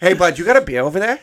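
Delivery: a chummy rat asking for a beer; homie rat. Acted. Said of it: "they're the homies".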